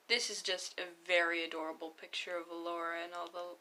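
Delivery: usual speaking voice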